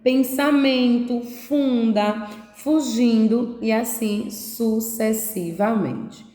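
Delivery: put-on each word stretched out slowly for young readers; slow, clear reading of "canta", "semente", "cansada". Shown as a demonstration. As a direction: read the list slowly, word by word. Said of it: "eu tenho aqui".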